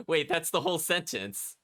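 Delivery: laughing in disbelief. Real, not acted. Baffled by a one-line game description.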